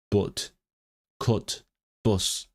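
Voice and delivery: "but, cut, bus" said with a short Northern English u; non-posh Northern English u in "but, cut, bus"; non-posh Northern English accent. Shown as a demonstration. Showing non-posh Northern English vowels to viewers. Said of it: "non-posh Northern English would be more like".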